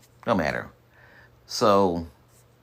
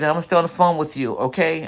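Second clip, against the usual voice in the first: pitched up, quick reassurance; high quick wife voice; 7 semitones higher than usual. Retold or imitated. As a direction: play the wife, fast and high.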